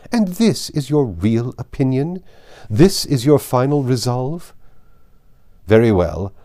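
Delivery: a hint of an aristocrat issuing a final warning; Lady Catherine. Lady Catherine ending the quarrel with Elizabeth, mildly threatening.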